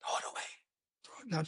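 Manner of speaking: unvoiced slow whisper. Real, not acted. Aside whisper.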